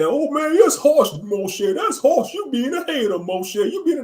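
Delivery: mocking critic. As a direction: squeal it like a shocked busybody. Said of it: "somebody hear me saying".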